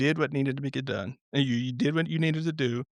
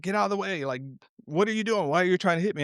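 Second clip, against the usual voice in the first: raised, higher, a half-hearted retold yell; mock yell at boat; 6 semitones higher, faster than usual. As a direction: play-act shouting at another boat, lightly. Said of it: "you'll yell at".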